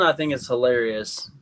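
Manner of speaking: normal talking voice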